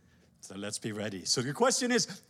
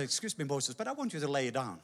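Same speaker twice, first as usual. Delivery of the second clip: lower, softer, quick; gentle command; 5 semitones lower, 5 dB quieter than usual. Acted. God telling Moses to lay down his rod, soft. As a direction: calmly insist he lets go.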